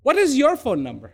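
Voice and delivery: quick, run-together question; fast, matter-of-fact asking; brisk question. Shown as a demonstration. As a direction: ask it briskly in one breath. Question first put briskly.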